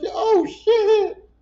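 high, loud, astonished cry; mock shocked shriek. Imitating Bubba's mama getting all that money, mock shock. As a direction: shriek it as if about to faint.

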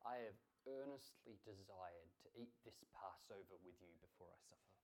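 low, hushed reading of Jesus's words; quiet, solemn quotation; solemn scripture quote. Retold or imitated. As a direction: quote it softly and low, fading at the end. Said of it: "And what does Jesus say?".

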